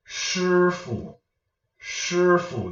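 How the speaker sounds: "Shi" held high and level, "fu" dropped short; neutral fifth tone. Shown as a demonstration. Teacher showing viewers where neutral tone comes, high then neutral. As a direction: sustain a high "Shi", then let "fu" fall away unstressed. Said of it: "This is where the fifth tone".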